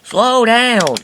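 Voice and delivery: high, loud plea; crying out. A narrator's reading. The coaches beg racing Diesel, high loud cry. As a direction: yell it up high to stop him.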